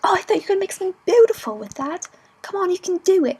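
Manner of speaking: high, loud coaxing voice; pushy stranger. Retold or imitated. Stranger urging the girl on the tube, pushy coaxing. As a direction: coax her like a pushy stranger. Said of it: "It's like".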